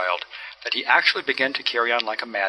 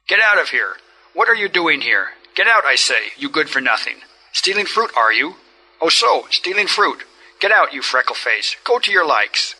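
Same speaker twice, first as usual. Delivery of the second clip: louder, higher furious yelling; threatening shout; 4 semitones higher, 5 dB louder than usual. A narrator's reading. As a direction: drive the boy off with rage. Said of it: "began to threaten the red-haired boy".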